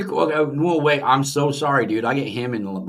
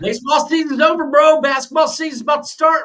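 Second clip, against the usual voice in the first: pitched way up, exasperated and loud; exasperated exclaim; 12 semitones higher, 3 dB louder than usual. Real, not acted. Cohost telling his friend to move on, exasperated high exclaim. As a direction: throw your hands up at your buddy.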